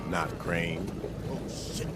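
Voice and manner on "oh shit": soft breathy whisper under breath; whispered curse. Acted. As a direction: hiss it so nobody hears.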